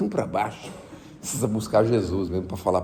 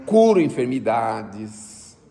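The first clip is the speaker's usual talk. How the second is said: ordinary talk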